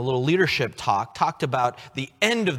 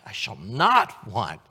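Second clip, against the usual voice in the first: slow, NOT punched and loud; firm stress on not; 4 semitones lower, 4 dB louder, slower than usual. Shown as a demonstration. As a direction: hit the word not. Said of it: "emphasize different words".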